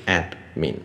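“admin”慢慢念成ad-min; “admin”的d后不加母音; 两个音节. Shown as a demonstration. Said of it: "它只有两个音节". (分两拍念“admin”：ad、min)

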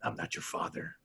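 hushed mock whisper; whispered line; whispered denial. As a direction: whisper it flatly. Voicing character denying.

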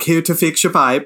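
mock Swedish accent. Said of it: "That accent".

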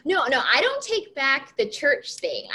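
ordinary talk, nothing marked

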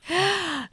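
a sharp high gasp. Acted. Playful lover gasps before a mock courtly line.

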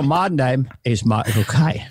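slow Cockney impression; Michael Caine. Shown as a demonstration. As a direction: draw out the Cockney vowels. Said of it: "I think I'm Michael Caine".

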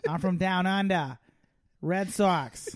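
mock Australian Boston fan; Australian-Boston accent. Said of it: "pretend like you're Australian doing".

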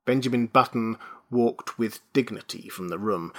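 ordinary delivery

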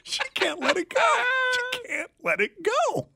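high, whiny mock sob; mock whining. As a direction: whimper it like a crybaby. Teasing co-host over grievance.

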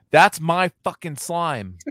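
put-on high, slow, loud kid's protest; indignant four-year-old. Dad imitating four-year-old over slime, high and indignant. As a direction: imitate a small child staking a claim. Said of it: "my four-year-old goes".

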